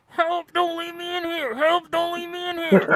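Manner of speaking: very high little trapped voice; high trapped earbud crying for help; tiny AirPods voice. Retold or imitated. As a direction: squeak like something trapped in a case. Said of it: "all you hear is".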